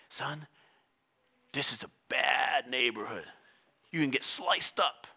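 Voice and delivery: low, hushed and slow; ominous warning father. Retold or imitated. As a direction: warn your son in a hushed voice. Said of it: "what if the dad told him".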